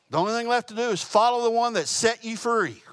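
preacher's loud call to follow; fervent preaching. Real, not acted. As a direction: proclaim it to the congregation. Preaching climax.

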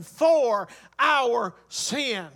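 "for our sin" stretched out very slowly; emphatic preaching. Real, not acted. Preacher sums up the Greek word's meaning, "for our sin" slow and emphatic. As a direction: land "for our sin" word by word, long and weighty.

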